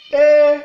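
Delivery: E on a high, level, loud tone. Shown as a demonstration. Teacher showing the tones, E on high tone. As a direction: hold E high and even. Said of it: "ton haut".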